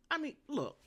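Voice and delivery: ordinary talk, nothing marked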